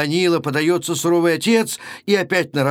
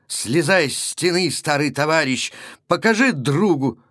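ordinary delivery, nothing marked

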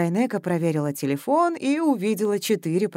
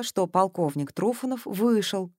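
ordinary delivery

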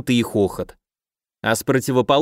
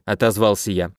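usual speaking voice

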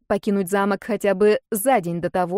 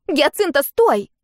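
high, louder cry to stop; piercing exclamation; 7 semitones higher, 5 dB louder, slower than usual. A narrator's reading. Younger sister stopping her elder sister's hug, piercing. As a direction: cry out sharply, pitch well up. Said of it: "пронзительно воскликнула она".

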